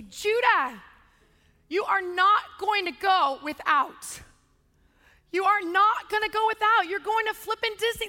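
loud insistent telling-off; stern exasperated parent. Retold or imitated. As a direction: lay down the law, word by word. Retells coaching and terrorizing upset son.

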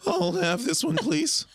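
high, squeaky mock-innocent voice, cracking into a laugh; a badly hidden grin trying to sound casual; fake straight face. Bandmate acting innocent at the record store counter, high and giggly. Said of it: "try his best to keep a straight face and go".

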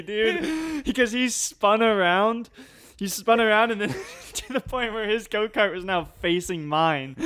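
high, laughing through the words; cracking up mid-story. Real, not acted. Laughing while telling the story.

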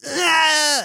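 hammed-up movie scream; mock scream. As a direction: wail it like a dying extra. Death scene in slow motion.

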